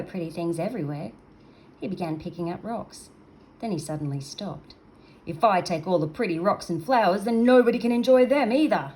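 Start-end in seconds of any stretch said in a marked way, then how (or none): 5.3-8.9 louder creature voice rising to a loud finish; the monster works it out aloud; dawning creature realisation. Acted. Creature realises, getting louder and rising.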